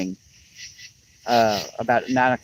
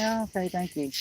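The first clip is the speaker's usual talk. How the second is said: sarcastic; 7 semitones higher, 9 dB quieter than usual. Retold or imitated. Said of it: "I'm like".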